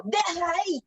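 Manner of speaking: high-pitched scolding call; teacher demonstrating a shouted command; demonstrated yell. Shown as a demonstration. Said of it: "¿Estoy hablando normal o estoy gritando?".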